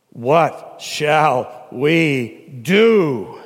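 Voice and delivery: drawn out, word by word; weighty question. Retold or imitated. Stricken crowd asks the apostles, weighty and very slow. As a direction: stretch every word very slowly.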